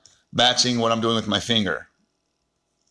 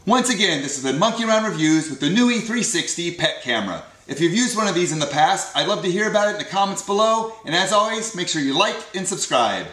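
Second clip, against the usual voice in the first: loud, upbeat channel sign-off read; outro sign-off; 6 semitones higher, 6 dB louder than usual. Acted. Host closing the pet camera review for viewers, louder upbeat sign-off. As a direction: sell the outro like a YouTube host.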